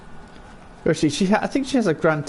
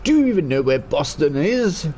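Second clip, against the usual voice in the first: lightly posh English quote; 4 semitones higher than usual. Retold or imitated. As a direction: voice the chatter in a posh English accent.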